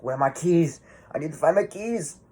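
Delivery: mock-panicked killer tearing up tables; mock frantic search. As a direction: play Michael as a flustered man hunting his keys. Voicing Michael flipping tables.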